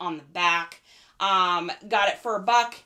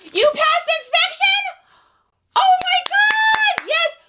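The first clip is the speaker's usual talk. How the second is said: excited shriek; 9 dB louder than usual. Real, not acted. Friend passed inspection, cheering.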